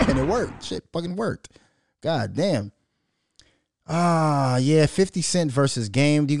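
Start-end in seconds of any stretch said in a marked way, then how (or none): none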